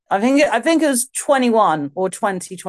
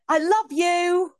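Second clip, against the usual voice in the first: mock declaration; 10 semitones higher, slower than usual. Flirting, confessing love to Joan.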